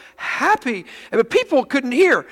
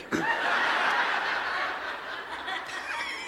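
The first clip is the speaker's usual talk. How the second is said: breathless laugh; 10 semitones higher, 11 dB quieter than usual. Real, not acted. Audience-style helpless laugh.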